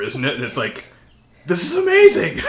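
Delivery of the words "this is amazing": high squealed amazement; mock amazement. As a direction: squeal it out, wide-eyed. Mock awed reaction.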